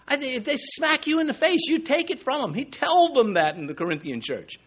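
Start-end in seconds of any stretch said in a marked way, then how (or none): 0.1-2.5 high mocking quote. Quoting Paul, high voice.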